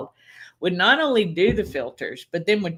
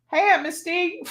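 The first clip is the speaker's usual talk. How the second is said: put-on much higher, bright child voice; cheerful kid greeting; 11 semitones higher than usual. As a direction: sing out a hello like a child.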